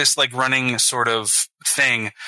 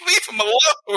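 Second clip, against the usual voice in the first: 1 high mock plea; 9 semitones higher than usual. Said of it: "and I'm like".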